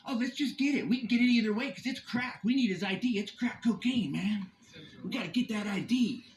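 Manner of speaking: hammed-up officer, eager for a bust; mocking the cops. Taunting the police from his car, mock cop voice. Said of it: "look at that face".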